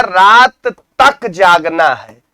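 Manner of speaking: slow, "तक" set off and punched; stress on तक. Shown as a demonstration. Teacher asking where the emphasis falls, stress on "तक". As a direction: lean hard on "तक" in a slow example. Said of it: "अब हमने जोर किस पे दिया है".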